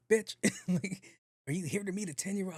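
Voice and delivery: laughing, fast mock of her; mock her line through a laugh. Retelling the lady's accusation, laughing.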